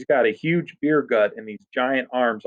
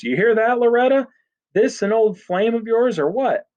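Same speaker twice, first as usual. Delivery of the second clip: high-pitched amused jeer; mocking taunt; 11 semitones higher than usual. A narrator's reading. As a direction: tease her with a big man's mockery, voice raised. Big man laughs, then taunts Loretta.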